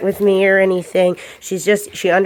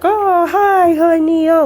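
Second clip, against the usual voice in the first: put-on much higher, louder excited baby talk; gushing dog greeting; 11 semitones higher, 5 dB louder, slower than usual. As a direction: gush over the dog like a doting owner. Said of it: "and they're like".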